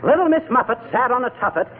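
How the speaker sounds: rattled nursery rhyme recital; storytelling voice. Acted. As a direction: hunt for a story without food. Fumbling through nursery rhymes.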